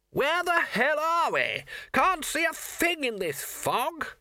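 very high, slow calling; exasperated yell. A narrator's reading. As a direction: call out, lost in the fog. Lost flyer calls out in fog.

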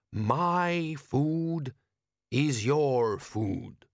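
put-on slow, accented, ceremonious elder voice; desert wise man. A narrator's reading. Wise man answers his foreign guest, slow and stately. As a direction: intone it slowly as a foreign sage.

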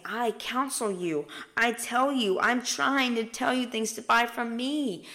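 earnest, forceful appeal; urgent pleading. Retold or imitated. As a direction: press each promise home insistently. Voices Jesus urgently advising listeners.

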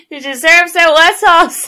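high, loud laughing tease; teasing laugh. Real, not acted. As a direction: tease her through laughter.

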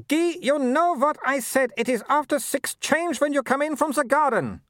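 high, imperious scolding; scolding Baroness. A narrator's reading. Mother scolding husband for changing, high imperious voice. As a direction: order him about like an errant dog.

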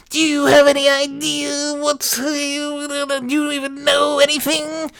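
very high sustained wail; mock yelling church lady. Old church lady badgering the son, mock yelling. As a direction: drone it shrilly on one high, stretched note. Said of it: "old church ladies yelling at me".

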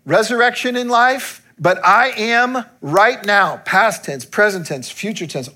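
loud, high impassioned preaching; the preacher reaches his climax, high and loud; preaching at full force. Acted. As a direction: proclaim it to the whole church, full voice.

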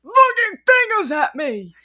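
outraged. Real, not acted. Outraged retelling.

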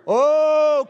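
long, loud, drawn-out "oh"; big provoking exclamation; loud teasing "oh". Real, not acted. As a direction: holler a stretched "oh" at the congregation. Teases congregation after provocative line.

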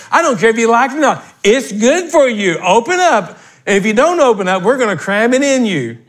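high, insistent pulpit delivery; fired-up preacher overruling every objection; forceful preaching. Real, not acted. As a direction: preach it as a firm order.

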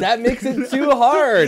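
high, loud, slow protest; indignant, drawn-out outcry; outraged. Real, not acted. Outraged at altered quiz question.

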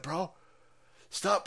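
ordinary talk, nothing marked

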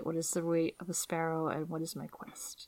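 whispered to herself. Real, not acted. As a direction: breathe it out as a whisper. Mock pondering, whispered.

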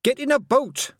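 high, loud urgent shout. Acted. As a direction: yell it at him. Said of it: "Tiffany shouted".

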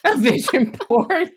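incredulous giggle at a silly idea; can't say it without laughing; laughing disbelief. Real, not acted. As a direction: laugh through it, pitched high, mocking. Mocking the vision board idea.

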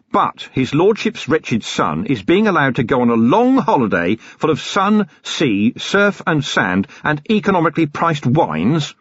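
rising bitter complaint; indignant. A narrator's reading. Vimes protesting to the Patrician, bitter and rising. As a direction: build up to slamming the desk.

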